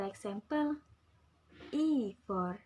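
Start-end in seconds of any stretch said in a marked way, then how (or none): none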